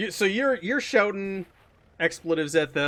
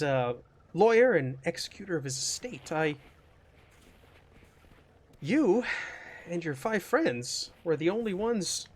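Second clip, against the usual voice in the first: nervous lawyer; 7 dB quieter than usual. Acted. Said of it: "like, nervous".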